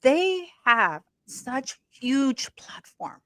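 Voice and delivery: slow, confiding whisper; hushed aside about Harry and Meghan. Real, not acted. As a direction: lean in and whisper it slowly.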